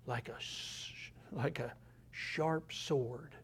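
dropped to a near whisper, slow; hushed. Acted. Preacher hushing the verse to his listeners, slow and soft. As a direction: let the sword line fall to a hush.

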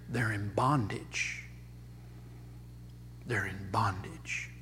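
hushed, low-pitched solemn repetition; hushed, grave. Real, not acted. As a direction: drop right down to a quiet, low aside. Preacher solemnly repeats a grave point.

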